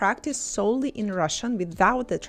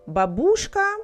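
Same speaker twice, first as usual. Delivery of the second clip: бабушка stressed on the second syllable, higher; wrong stress; 8 semitones higher, 5 dB louder than usual. Shown as a demonstration. Teacher showing learners the incorrect pronunciation, бабушка stressed on the second syllable. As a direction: punch the middle "BUSH" of бабушка, pitched up. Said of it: "is incorrect pronunciation of the word".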